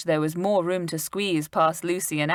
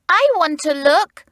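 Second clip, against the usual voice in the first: put-on much higher, louder girl's demand; bold shout; 11 semitones higher, 7 dB louder than usual. A narrator's reading. Anne insisting on the window, bold shout. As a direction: boldly insist. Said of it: "shouted out Anne boldly".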